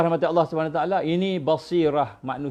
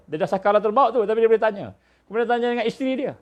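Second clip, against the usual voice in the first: ordinary talk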